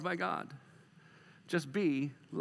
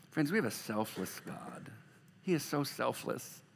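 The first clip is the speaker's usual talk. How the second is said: ordinary delivery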